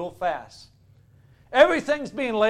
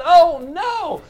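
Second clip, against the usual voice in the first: loud, high-pitched retold protest; mock alarm; 8 semitones higher, 7 dB louder than usual. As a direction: exclaim it like scandalized religious leaders. Religious leaders object to the praise.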